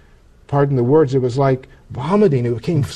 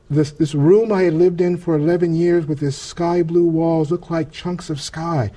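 ordinary talk

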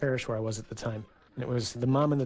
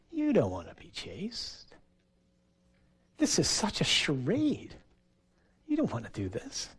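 tempter's taunt. Retold or imitated. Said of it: "the little accuser ... he says".

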